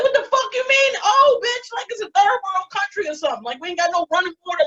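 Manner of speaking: high-pitched offended comic rant; indignant outburst. Acted. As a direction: fire back like your hometown was insulted. Indignant reply to the room's "Oh.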